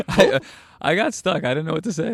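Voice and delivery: high, loud giggling words; laughing it off. Real, not acted. Host laughing at himself for getting stuck mid-question, laughing.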